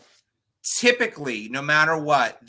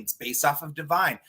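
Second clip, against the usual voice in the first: normal talking voice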